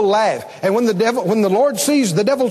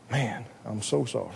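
much lower, much softer pity; pitying murmur; 12 semitones lower, 13 dB quieter than usual. Retold or imitated. Worldly visitor consoling the dying, low and hushed. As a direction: mourn with them softly. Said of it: "feel sorry for you".

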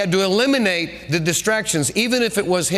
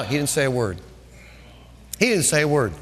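ordinary talk, nothing marked

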